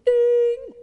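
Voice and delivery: high, loud sung "Ding" like the ad; a bright jingle note, loud and high; commercial chime. Retold or imitated. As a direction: imitate the airline ad's chime. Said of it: "You know those Southwest commercials?".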